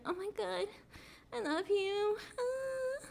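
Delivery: high, whiny fake sobbing plea; mock pleading. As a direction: beg an ex with hammed-up tearfulness. Mimics exes begging to reunite.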